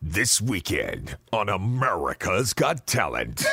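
aspiring voiceover guy reading a promo in a deep voice; deep announcer voice. Retold or imitated. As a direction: boom it out like a TV promo in a forced American accent. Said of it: "he's got a deep voice".

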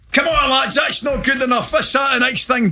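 high, shouted rallying at the lads; hammed-up fired-up player; mock team talk shout. Footballer yelling at teammates to get in the film, high shout.

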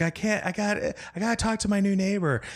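high frazzled neighbor. Retold or imitated. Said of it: "she's like".

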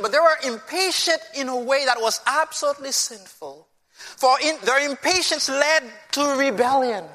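ordinary talk

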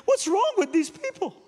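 high, loud tearful wail, imitated; emotional outburst. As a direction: wail it as the overwrought cleaning lady. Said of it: "I'm sorry to be emotional".

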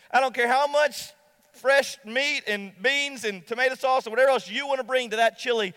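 ordinary delivery

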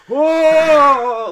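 loud, high imitated wail; mock old-world bawling. Retelling an old man crying in the waiting room, loud mock bawling. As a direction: wail like a vampire waking up, hammed-up. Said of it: "old world bawling".

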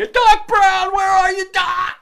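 high, loud shouting call; Marty McFly calling out. Retold or imitated. The friend imitated as Marty, shouting high for Doc Brown. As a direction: yell for Doc in a panic. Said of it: "He's like".

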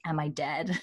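mild slurred, faint "Am I dead?"; half-laughing slurred drowsy question; weak slurry voice. Retold or imitated. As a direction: lightly imitate a weak, drunk-sounding man. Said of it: "kinda slurry, drunk voice".

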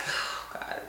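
whispered, overcome; barely a breath, overwhelmed; soft whispered oh God; breathless whisper. Real, not acted. Overwhelmed by memory, breaking off.

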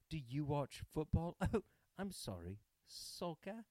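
condescending mock British toff; posh British accent. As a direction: mimic a snooty upper-crust Brit correcting themselves. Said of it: "They were like".

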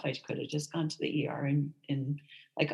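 normal talking voice

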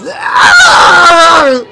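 high, very loud scream of agony; agonized scream. A narrator's reading. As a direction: scream out as if your head is splitting. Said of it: "he screams".

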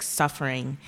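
usual speaking voice

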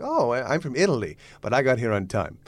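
teasing Italian clown voice; comic Italian accent; stupid Italian accent. Acted. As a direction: needle the latecomer as a silly Italian. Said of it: "in this stupid Italian accent".

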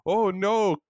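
imitating Chekhov. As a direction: ham up a horrified Chekhov shout. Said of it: "Chekhov being like".

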